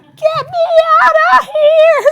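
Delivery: very high, loud, slow wail; hammed-up cry of a worn-out husband; desperate husband. Imitating the husband on kids duty, shrieking high and loud. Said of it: "he's like".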